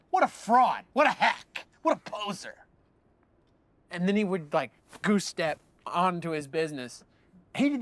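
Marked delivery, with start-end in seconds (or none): 0.1-2.5 mock scorn. Satanist customer scolding the cashier, imitated higher scorn. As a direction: spit out each insult separately, pitched up.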